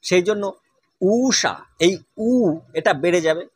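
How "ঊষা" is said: ঊষা with the ঊ held long; ঊষা টেনে বলা. Shown as a demonstration. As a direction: stretch the opening vowel of ঊষা. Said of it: "দীর্ঘ হবে".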